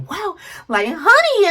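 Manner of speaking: high, delighted squeal on honey; light up with happy surprise; excited greeting. Acted. Spouse coming home to wife and kids, excited greeting. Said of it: "you should be like".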